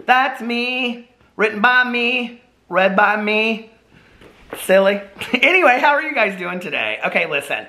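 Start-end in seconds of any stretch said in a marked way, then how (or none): none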